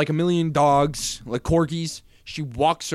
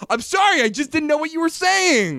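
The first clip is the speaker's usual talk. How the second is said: high squeaky apology; 12 semitones higher than usual. Real, not acted. Apology after quarrel.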